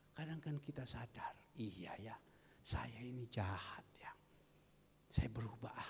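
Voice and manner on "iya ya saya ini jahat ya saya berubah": lower, whispered confession; whispered realization. Retold or imitated. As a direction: murmur it to yourself. Voicing inner realization. (pengakuan berbisik)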